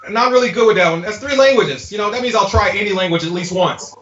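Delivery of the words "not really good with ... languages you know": ordinary talk, nothing marked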